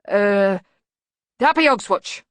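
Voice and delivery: loudly voiced bogeyman, lifting in pitch. A narrator's reading. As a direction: call out an awkward goodbye. Bogeyman's awkward farewell.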